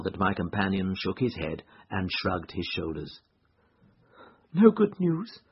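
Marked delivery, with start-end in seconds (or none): 4.5-5.4 high-pitched anxious woman's question; anxious wife. A narrator's reading. Wife asks detective for news, anxious and high. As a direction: ask high, dreading the answer.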